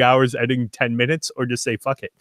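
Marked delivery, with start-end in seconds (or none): none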